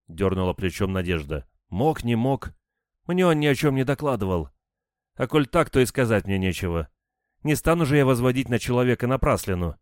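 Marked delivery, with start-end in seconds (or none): 1.7-9.8 higher-pitched, indignant woman's voice; defensive village woman. A narrator's reading. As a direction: brush off the questioners, standing your ground. (возмущённо, высоким женским голосом)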